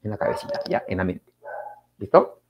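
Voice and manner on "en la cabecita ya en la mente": whispered aside. Shown as a demonstration. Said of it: "Fonema es acá".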